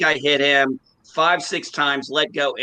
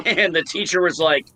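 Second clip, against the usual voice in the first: ordinary talk, nothing marked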